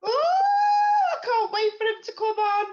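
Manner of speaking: high, loud and drawn-out gush; excited. Real, not acted. Host to viewers about the guest coming on, excitedly gushing. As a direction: squeal with eager anticipation.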